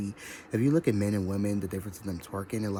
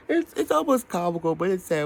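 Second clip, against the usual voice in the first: laughing high; 11 semitones higher, 6 dB louder than usual. Real, not acted. Laughing commentary on show.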